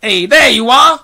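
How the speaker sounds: high, loud call. A narrator's reading. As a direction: call up to someone spotted. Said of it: "called the chief of police".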